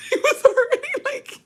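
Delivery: high, squeaky words through laughter; real giggles breaking up the sentence; laughing too hard. Real, not acted. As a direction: try to talk while cracking up. Cracking up while telling story.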